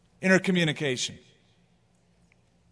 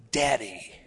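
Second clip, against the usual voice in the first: put-on baby's first word; 6 semitones lower than usual.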